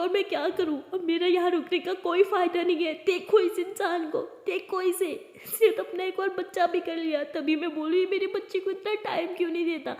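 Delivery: high, loud tearful outburst; crying angrily. Acted. Rimsha, betrayed by her husband, shouts through tears. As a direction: rage through sobs. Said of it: "और ज़ोर ज़ोर से रोने लगती है".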